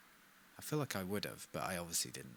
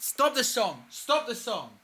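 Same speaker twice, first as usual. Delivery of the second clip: shouted; 9 semitones higher than usual. Pretend. Mock plea to stop song.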